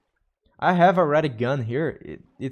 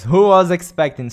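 louder, higher excited call-out; excited exclaim; 6 semitones higher, 11 dB louder than usual. Real, not acted. Streamer, still rattled by the creeper, asks chat to clip it loudly. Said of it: "so much afraid".

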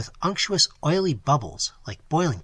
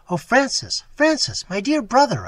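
high, loud desperate crying out to a brother; anguished cry; 10 semitones higher, 6 dB louder than usual. Acted. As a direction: cry his name out in terror, much higher. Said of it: "I cried".

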